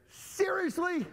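high-pitched disbelieving reaction; incredulous. Acted.

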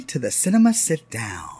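breathy stage whisper. Acted. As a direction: whisper the show name.

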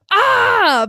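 sudden high yelp of retold fright; mock scream. Recalling the trike scene, a mock startled scream. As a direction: yelp it out like a jump scare. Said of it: "and I got scared".

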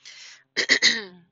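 loud, sharp throat clear. Real, not acted. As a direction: clear the throat hard before speaking. Clears throat before the topic.

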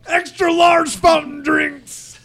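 high, slow hammed-up yell; high mock shout. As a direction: squeal it out like a silly ad. Shouting Wendy's menu items game.

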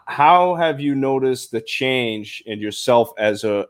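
ordinary delivery

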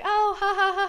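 high, loud put-on laughter; a sarcastic fake laugh; mock fake laugh. Mimics sister's mood swing.

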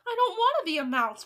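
high, loud tantrum shout. A narrator's reading. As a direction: shout it in furious refusal. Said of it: "Bruno shouted".

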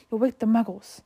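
plain reading voice

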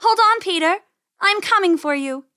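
high, loud urgent shout; calling out. A narrator's reading. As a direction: shout to a trapped brother. Penny shouts to her trapped brother.

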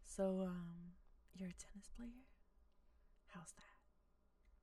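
hushed, awkward whispered line; whispered reading. Shown as a demonstration. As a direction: whisper the line like a nervous flirt. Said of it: "Let's do the dialogue".

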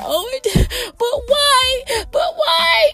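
high, wailing mock sob; hammed-up tearful wail; mock crying. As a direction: whine it like crying at a sad movie. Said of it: "y'all should see me crying".

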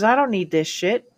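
normal talking voice

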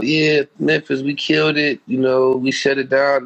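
high, slow, hammed-up boast; mock victory lap; mock bragging. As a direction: play the boastful post you didn't write. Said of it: "I didn't say".

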